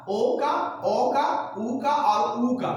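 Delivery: slow, deliberate vowels ओ and ऊ, each drawn out; slow vowel demo. Shown as a demonstration. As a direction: spell out ओ and ऊ slowly for the class. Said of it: "देखिए आ का".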